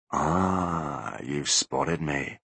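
very slow, soft and low; the ghost revealing itself, slow and soft; slow soft ghostly voice. A narrator's reading. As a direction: savour the moment. Ghostly Butler voice.

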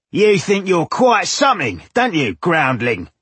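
high, belligerent villager voice; riled villager picking a fight; hostile taunt. A narrator's reading. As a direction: sneer a challenge at the outsider. Villager taunts dwarf in quarrel.

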